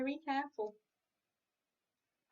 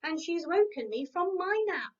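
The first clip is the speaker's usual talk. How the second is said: louder, higher, a cross hen's cluck; 5 semitones higher, 6 dB louder than usual. Acted. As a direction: scold like a grumpy hen woken from her nap. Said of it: "clucked Hetty Hen crossly".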